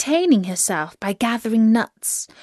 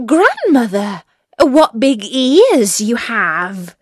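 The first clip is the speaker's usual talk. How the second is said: put-on amazed little girl; 3 semitones higher, 6 dB louder, slower than usual. A narrator's reading. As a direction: draw out the astonishment as a girl. Amazed child questions grandmother.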